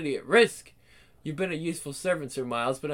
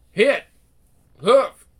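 fighting grunts; 4 semitones higher, 10 dB louder than usual. Acted. Fight blows.

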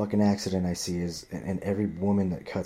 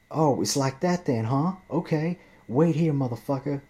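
mock threatening woman; 7 semitones higher, 4 dB louder than usual. Angry driver threatening him before leaving, mimicked higher. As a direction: imitate her menacing warning. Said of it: "fucking screaming at me".

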